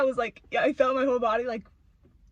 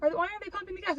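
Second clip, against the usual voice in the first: innocent asking wife; 9 semitones higher, faster than usual. Retold or imitated. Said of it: "if she was just like".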